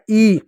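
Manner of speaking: "ई" held out as a long vowel. Shown as a demonstration. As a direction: stretch the sound of "ई". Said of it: "इसका उच्चारण लंबा करते हैं".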